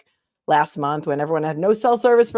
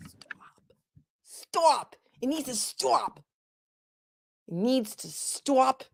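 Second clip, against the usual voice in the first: higher, half-hearted parody of the pleading speech; a hint of mock outrage; mocking echo; 8 semitones higher, 6 dB quieter, much slower than usual. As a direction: mimic the plea with exaggerated drama. Mocking the speaker's "needs to stop.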